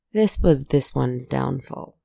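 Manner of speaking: usual speaking voice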